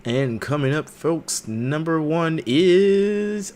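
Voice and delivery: drawn-out showman's reveal; game-show host. Pretend. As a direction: announce it like a host, stretching the end. Host presenting the first item.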